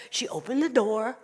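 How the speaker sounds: ordinary delivery, nothing marked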